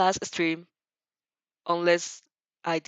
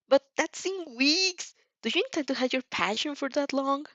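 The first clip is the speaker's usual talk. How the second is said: put-on passionate boy; 7 semitones higher than usual. Inasa voiced by reader.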